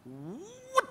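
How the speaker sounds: high sharp whoosh; whoosh of speeding car. Retold or imitated. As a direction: mimic the car shooting off. Suara mobil ngebut. (tiruan suara mobil melesat)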